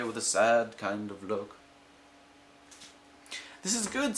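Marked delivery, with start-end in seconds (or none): 3.6-4.2 relieved fish. Acted.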